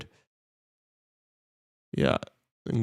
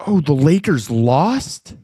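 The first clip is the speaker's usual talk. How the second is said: surprised; 5 semitones higher, 6 dB louder, slower than usual. Real, not acted. Hears the Lakers lost.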